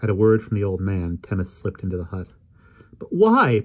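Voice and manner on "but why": put-on high, loud girl demanding; passionate demand. Polly demanding an answer from Adam, high loud girl. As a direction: insist on knowing why. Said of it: "Polly demanded passionately".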